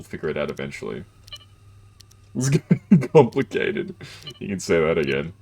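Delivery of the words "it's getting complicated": slow, laughing through the words. Real, not acted. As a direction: giggle while saying it. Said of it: "laughing at confusion".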